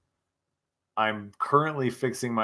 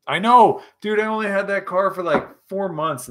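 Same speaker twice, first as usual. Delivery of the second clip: high, loud exclamation; exclaiming; 8 semitones higher, 8 dB louder than usual. Real, not acted. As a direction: burst out in agreement, high and loud. Exclaiming in reply to a chat comment.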